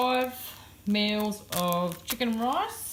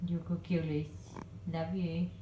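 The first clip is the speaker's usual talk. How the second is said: usual speaking voice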